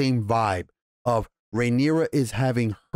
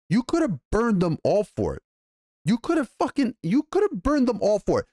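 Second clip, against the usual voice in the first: high-pitched retold rebuke, much higher; acted furious reproach; 9 semitones higher than usual. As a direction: pitch it way up, throwing the blame at her. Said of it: "he tells her".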